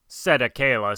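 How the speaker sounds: plain reading voice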